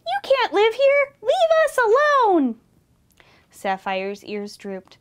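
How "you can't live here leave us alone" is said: high, loud angry squeal; high-pitched outraged command; bellowing mouse. A narrator's reading. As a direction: bellow it as a tiny furious mouse. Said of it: "bellowed Mouse".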